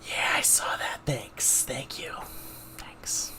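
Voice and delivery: soft unvoiced whispered thanks. Real, not acted. Streamer thanks viewers quietly, whispering. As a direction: murmur thanks under the breath. Thanking chat under breath.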